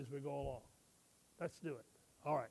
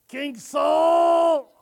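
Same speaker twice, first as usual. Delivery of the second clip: shouted call at full volume; a loud yell for the king; too loud; 9 semitones higher, 16 dB louder than usual. Acted. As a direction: bellow the name across the room. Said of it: "Not so loud".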